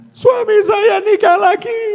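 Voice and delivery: very high, slow tearful woman; weeping woman caller. Pretend. Woman on the phone to the preacher, high tearful wail. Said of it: "Ada apa, Bu?".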